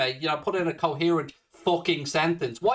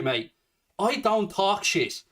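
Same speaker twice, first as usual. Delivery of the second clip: normal talking voice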